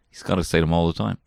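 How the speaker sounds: ordinary talk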